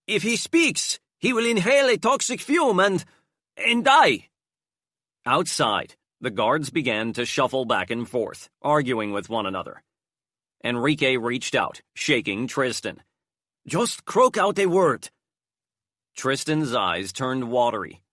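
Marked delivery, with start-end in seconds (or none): 0.0-4.3 high, loud urgent warning; urgent bluff. A narrator's reading. Enrique bluffs the guards, high and urgent. 13.6-15.1 high urgent plea; pleading. A narrator's reading. Enrique begs Tristan to speak, high and urgent.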